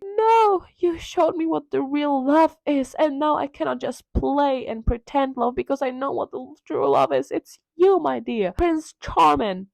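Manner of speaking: high, earnest damsel voice; hammed-up romantic protest; mock lovestruck Sybil. Sybil explaining true love to Dorian, high mock-lovestruck voice.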